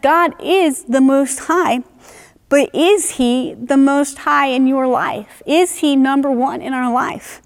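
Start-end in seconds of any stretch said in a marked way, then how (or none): none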